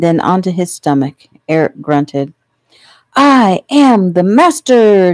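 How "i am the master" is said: put-on high, loud, drawn-out boyish boast; triumphant yell. A narrator's reading. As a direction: crow it slowly like a little conqueror. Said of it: "Joey yelled triumphantly".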